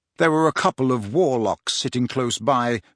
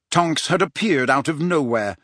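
ordinary delivery, nothing marked

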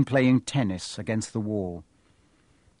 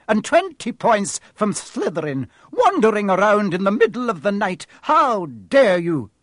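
higher and louder, outraged; furious scolding; 8 semitones higher, 5 dB louder than usual. Acted. McGonagall scolding Malfoy in the corridor, outraged.

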